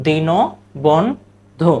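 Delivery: Dinabandhu said very slowly; slow pronunciation demo. Shown as a demonstration. Teacher modelling Dinabandhu, slow. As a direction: stretch Dinabandhu for the class. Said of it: "শব্দটা কি?".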